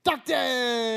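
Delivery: loud, high gleeful cry; excited shout. Real, not acted. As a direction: yell it with pure glee. Excited shout of favorite day.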